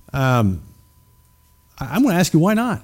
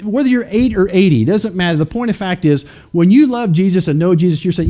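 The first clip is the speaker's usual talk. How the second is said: ordinary talk, nothing marked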